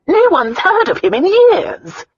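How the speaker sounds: high, loud, drawn-out protest; agitated protest. A narrator's reading. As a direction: protest it loudly in a soaring voice. Countess protests the shocking news.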